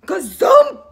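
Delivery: high, loud punchy Gazump!; loud sound effect. Shown as a demonstration. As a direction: shout it with the children. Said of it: "Can you all make the sound with me?".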